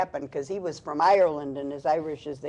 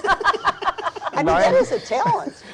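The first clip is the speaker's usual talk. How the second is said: amused; 13 semitones higher, 7 dB louder, much slower than usual. Real, not acted. Praising the accent demo.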